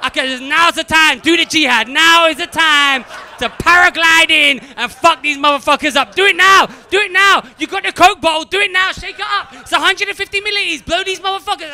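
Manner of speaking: high, full-force shouting; frenzied urging. Acted. Ancestors urging him on at the airport, frenzied shouted commands. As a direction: shout orders in a mounting frenzy.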